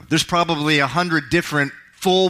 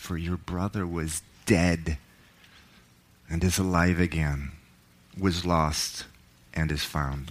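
softer and lower, gentle reassurance; tender father; 7 semitones lower, 7 dB quieter than usual. Acted. Father to older son, tender and quiet. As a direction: ease the son's anger softly.

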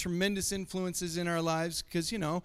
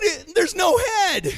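high, loud and slow, outraged; mock indignant kid; 12 semitones higher, 10 dB louder, slower than usual. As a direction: act the offended little Headless Horseman.

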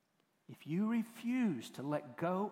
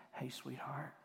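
soft, hushed gentle waking; tender wake-up; 3 semitones lower, 10 dB quieter than usual. Acted. Jesus waking the little girl, tenderly hushed. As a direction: coax a sleeping child awake, very softly. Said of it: "He just leaned over and said".

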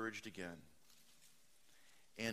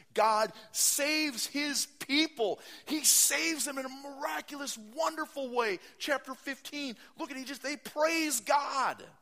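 much higher, loud, exultant proclamation; preaching climax; 12 semitones higher, 9 dB louder than usual. Real, not acted. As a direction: shout the victory to the congregation. Preacher exalting God's salvation.

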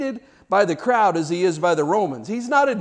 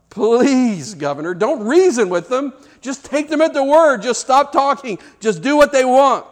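urgent plea; 7 semitones higher, 5 dB louder than usual. Retold or imitated. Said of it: "Barabbas is thinking to himself".

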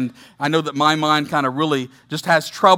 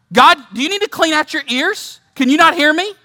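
higher, pleading challenge to God; mock exasperated; 9 semitones higher, 4 dB louder than usual. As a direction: throw the accusation up at God, exasperated. Preacher voicing Habakkuk's accusation.

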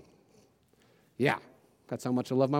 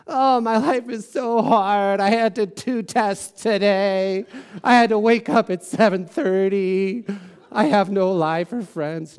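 put-on high, slow, loud whiny teen complaining; whiny teenager; 5 semitones higher, 5 dB louder, slower than usual. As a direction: drag out each complaint like a put-upon teenager. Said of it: "especially if you have, like, teenage kids, they'll do this all the time".

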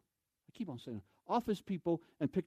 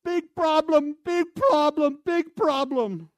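much higher, much louder foreign shout; yelling in broken English; 11 semitones higher, 10 dB louder, much slower than usual. Retold or imitated. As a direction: yell it like a frantic camp manager. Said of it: "he starts yelling in broken English".

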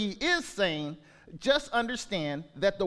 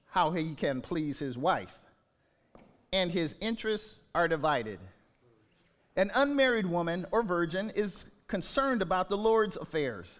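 scripture reading; 4 semitones lower than usual. Acted. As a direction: read each verse deliberately. Reads scripture verses aloud.

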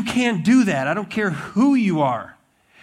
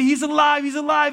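high, breathless-with-news excitement; acted-out joyful proclamation, pitched way up; excited announcement; 8 semitones higher, 4 dB louder than usual. Disciples report the resurrection, retold.

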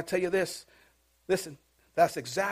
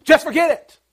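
loud, high, indignant outburst; upset and mad; 9 semitones higher, 13 dB louder than usual. Acted. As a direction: throw it out as an angry shout. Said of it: "get upset and mad".

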